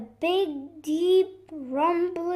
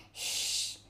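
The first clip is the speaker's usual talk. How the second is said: soft, long shush; whispered shush; 8 dB quieter than usual. Acted. Fox quietly warns her noisy friends, whispered shush. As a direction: hush them softly. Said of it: "she whispered".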